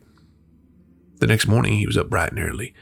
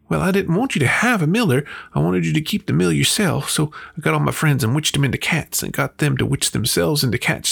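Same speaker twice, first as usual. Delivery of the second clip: high, louder, insistent confession; distressed witch wife; 8 semitones higher, 4 dB louder than usual. A narrator's reading. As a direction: pour it all out as the caught wife, pitched high throughout. Wife's confession in the story.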